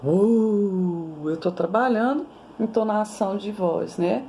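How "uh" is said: thunder imitation. Shown as a demonstration. Teacher imitating thunder for a story. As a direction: rumble like thunder. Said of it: "fazer um trovão?".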